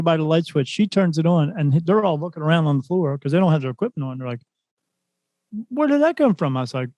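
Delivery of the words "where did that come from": startled question. Retold or imitated. Group puzzled by flash on floor.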